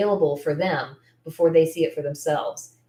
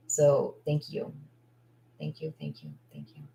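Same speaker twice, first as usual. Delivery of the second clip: holding back tears; 3 semitones lower, 7 dB quieter than usual. Real, not acted. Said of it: "I didn't cry".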